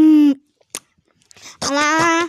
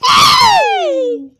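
loud yelped ouch; high startled cry; sharp high cry of pain; 13 semitones higher, 7 dB louder than usual. Acted. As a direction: yelp as if hit.

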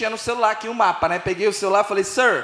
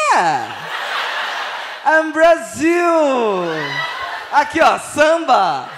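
slow drawn-out laughing shouts; excited Brazilian tourist; much slower than usual. Retold or imitated. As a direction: proudly shout the Brazil clichés. (gritando empolgado, rindo)

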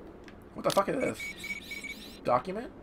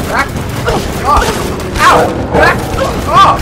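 louder, higher pained yell; yelling back off; 8 semitones higher, 5 dB louder than usual. Real, not acted. Gamer yelling at attacking wolves, pained. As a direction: shove them away with your voice.

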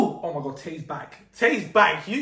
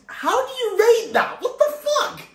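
astonished; 13 semitones higher, 5 dB louder than usual. Real, not acted. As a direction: blurt it out loud and high, stunned. Astonished at friend's success.

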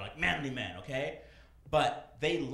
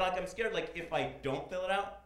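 ordinary delivery

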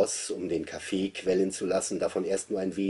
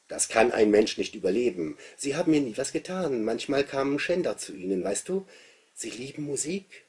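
put-on boy's voice; 7 semitones higher, 5 dB louder than usual. A narrator's reading. Narrator voices the boy Andreas.

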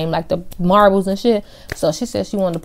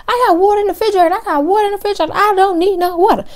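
put-on drunk girl; 13 semitones higher, faster than usual. Drunk girl refusing water.

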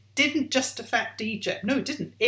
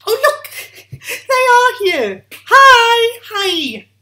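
high, loud, delighted hello with a laugh; excited greeting; 12 semitones higher, 13 dB louder, much slower than usual. Real, not acted. Presenter excitedly greeting children watching at home, high and loud. Greeting viewers arriving on camera.

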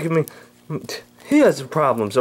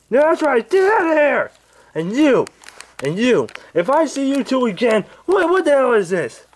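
Angry Grandpa voice; 11 semitones higher than usual. Acted. Old man woken by pranksters, high cranky yelling. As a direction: bark at the intruders like a furious grandpa.

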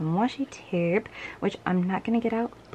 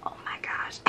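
whispered, breath caught; a gasp dropped to a whisper; 10 dB quieter than usual. Real, not acted. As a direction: hush it as if stunned. Gasp over photo card.

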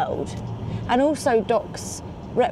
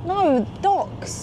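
DOCKS said clearly and set apart; emphatic correction; 9 semitones higher than usual. Real, not acted. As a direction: correct the listener, high NO then a distinct DOCKS. Corrects mishearing of the word docks.

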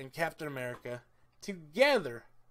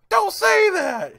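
much higher, much louder and slow yell; mock shout; 16 dB louder, slower than usual. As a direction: bellow it like an exasperated scold. Retelling Rocket scolding Groot.

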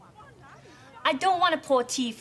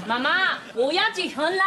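excited announcement; 5 semitones higher than usual. Acted. Announcing wedding to mother.

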